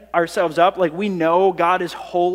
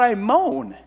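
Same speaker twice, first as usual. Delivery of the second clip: acted moan; 5 semitones higher than usual. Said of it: "Like this, this moan".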